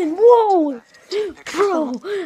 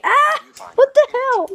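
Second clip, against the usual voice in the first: startled yelp; 12 semitones higher, 4 dB louder, slower than usual. Real, not acted. Startled in the dark.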